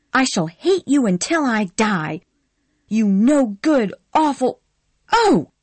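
high, loud shout rising to a shriek; furious. Acted. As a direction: lose control completely. Scarlett explodes at Ashley.

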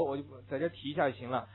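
normal talking voice

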